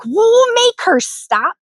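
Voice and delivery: high, slow, drawn-out mock complaint; put-on high voice, dragging the words; mock demand. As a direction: imitate an exasperated bystander's demand. Acts the expected complaint to father.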